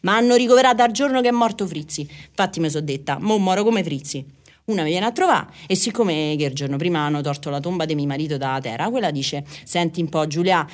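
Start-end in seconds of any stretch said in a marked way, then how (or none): none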